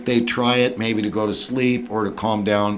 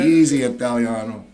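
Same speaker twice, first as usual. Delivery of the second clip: lightly hammed-up Italian accent; mock Italian. Italian accent teasing the counselor, a light mock. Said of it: "Or easy in Italian?".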